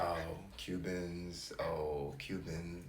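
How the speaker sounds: low, soft, deadpan imitation of co-host; mocking monotone. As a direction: drone it dully to tease your partner. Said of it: "compared to her monotone".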